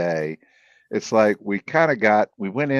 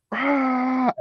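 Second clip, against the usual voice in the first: a short, high, strained cry of agony, retold; imitated agonized scream. Telling podcast friends how Eric screamed, imitated high anguished cry. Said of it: "super deep breath and just sucks it all in".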